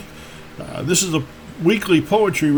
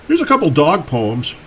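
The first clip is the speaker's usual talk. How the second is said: normal talking voice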